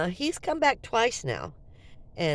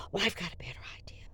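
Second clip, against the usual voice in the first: whisper; 10 dB quieter, faster than usual. Retold or imitated. Woman pitches her scheme secretly.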